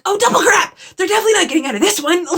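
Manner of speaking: high, rushed reader's panic; mock alarm. Reader reacting to another life-threatening situation, mock alarm.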